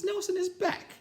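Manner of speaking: very high false voice; disguised teacher faking a high voice; put-on voice. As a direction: squeak it out to fool him. Disguised teacher fooling Blansworth.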